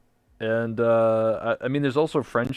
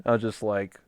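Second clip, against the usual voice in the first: ordinary talk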